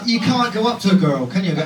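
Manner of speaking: ordinary talk, nothing marked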